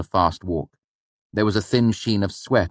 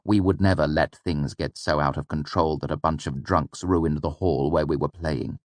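plain reading voice

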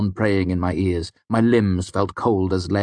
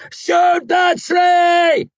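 very slow, drawn-out scream, much higher and louder; 7 dB louder, much slower than usual. A narrator's reading. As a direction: scream it with everything left. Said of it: "I screamed".

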